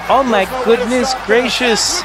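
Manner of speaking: high excited oh my goodness; delighted shocked exclamation; surprised squeal. Real, not acted. Surprised greeting to raiders.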